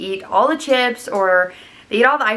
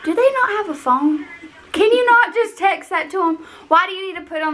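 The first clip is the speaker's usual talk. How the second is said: incredulous; 11 semitones higher than usual. Real, not acted. Rant about Facebook posts.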